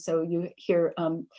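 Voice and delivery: usual speaking voice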